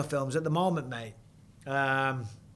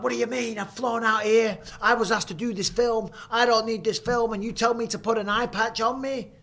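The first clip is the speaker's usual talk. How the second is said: retold indignant rant, high-pitched throughout; offended protest, pitched well up; kicking off; 7 semitones higher than usual. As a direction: act out losing your cool at the director. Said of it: "But I kicked off".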